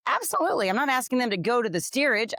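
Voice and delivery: high, emphatic willing reply; eager yes. Retold or imitated. Her imagined reply if asked to switch, high and eager. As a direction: sound cheerfully obliging. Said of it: "I would be like".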